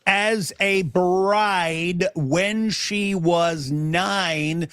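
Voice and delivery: very slow, each word spelled out for the opponent; drawn-out emphatic reading. Real, not acted. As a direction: read it out dead slow so he can't miss it. Rereads the hadith to the stubborn opponent.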